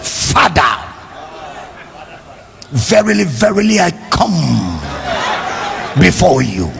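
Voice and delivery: mock-solemn prayer, halting and low; praying to impress. Someone trying to impress God in prayer, mock pompous solemnity. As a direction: intone scripture in lofty, pause-heavy phrases. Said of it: "they are trying to impress God".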